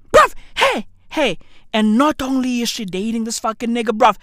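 usual speaking voice

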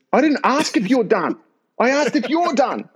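high, exasperated mock anger; angry and angrier. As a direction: imitate an instructor at the end of his patience. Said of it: "the staff's getting angry and angrier".

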